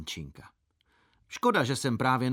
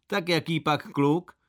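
higher, slow teasing doubt; doubting gentleman; 6 semitones higher than usual. Acted. Gentleman teasing the boy in a skirt, slow and higher. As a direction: tease the boy with disbelief.

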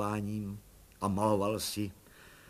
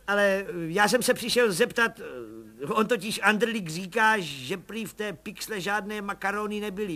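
high, eager little agent; proud little agent explaining the case; higher Pištora voice; 8 semitones higher, 4 dB louder than usual. A narrator's reading. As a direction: pipe it up like a keen small-time cop. Pištora's voice in the story.

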